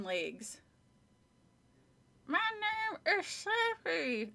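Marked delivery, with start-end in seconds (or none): 2.3-4.3 high, slow, through gritted teeth; ventriloquist's high dummy voice; squeaky dummy voice. Acted. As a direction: speak for the puppet without moving your lips. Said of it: "made the dummy say".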